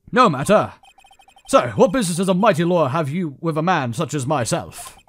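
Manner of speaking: put-on loud, haughty British accent. Arrogant CEO questioning a lawyer, haughty British accent. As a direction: wave it away in booming British. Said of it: "I'm doing a British accent".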